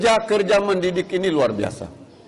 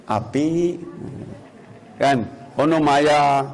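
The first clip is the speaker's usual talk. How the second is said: soft Javanese; 4 semitones lower, much slower than usual. Retold or imitated. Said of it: "lemah lembut".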